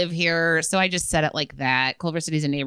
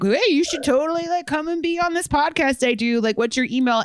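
higher-pitched, eager self-quote; eager invitation; 9 semitones higher than usual. Retold or imitated. Retelling her eager invitation.

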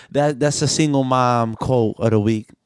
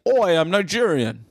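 higher, mocking London Nigerian accent; 5 semitones higher than usual. Mocking co-host's attempt at being Nigerian, London Nigerian accent. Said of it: "Nigerian accent".

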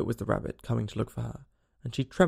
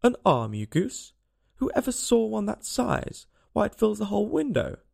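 indignant, pitch climbing higher; scolding; 7 semitones higher than usual. A narrator's reading. Rabbit scolding Pat about the arm, indignant. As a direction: scold the fool with rising disbelief.

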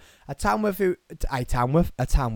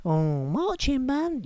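very high, slow put-on voice; exaggerated high-pitched astonishment; mock amazed listener; 12 semitones higher, slower than usual. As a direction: coo it slowly in falsetto. Voices listener's reaction to his revelation.

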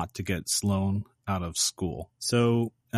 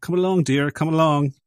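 higher, louder put-on call; lightly acted beckoning call; imitated calling out; 6 semitones higher, 6 dB louder than usual. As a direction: call out as if across a schoolyard. Imitates character calling out to girlfriend.

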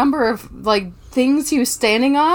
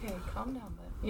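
very soft, distant calming of a pet; off-mic soothing; 21 dB quieter, faster than usual. Real, not acted. Host helping panicking cat, hushed off-mic reassurance. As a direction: settle the cat quietly, away from the mic.

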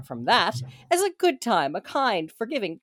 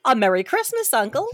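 cheerful Christmas greeting to a grumpy uncle; cheerful cry; 4 semitones higher than usual. Acted. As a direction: hail your uncle with festive cheer. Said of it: "cried a cheerful voice".

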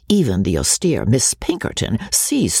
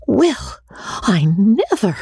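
shocked gasp; 9 semitones higher, slower than usual. Acted. Jemima shocked.

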